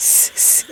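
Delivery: সি said as a bare voiceless "sss"; whistling sibilant সি, twice; hissed S. Shown as a demonstration. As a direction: hiss সি through the teeth, no voice. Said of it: "সি শব্দ করে সিন শ".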